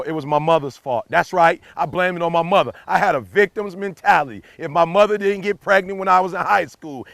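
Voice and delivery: usual speaking voice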